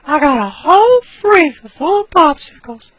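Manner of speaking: put-on creepy old man squeak; Herbert voice. Shown as a demonstration. Teacher quotes Herbert line, high quavering old man. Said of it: "so he's, he kinda".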